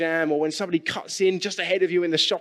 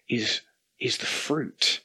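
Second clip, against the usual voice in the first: hushed; 4 semitones lower, 3 dB quieter, slower than usual. Real, not acted. Emphasising the key point.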